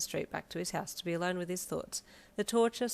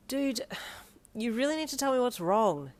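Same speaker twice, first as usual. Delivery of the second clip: higher, louder exasperated plea; exclaiming; 6 semitones higher, 4 dB louder than usual. A narrator's reading. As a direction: exclaim it with a sigh. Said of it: "you exclaimed".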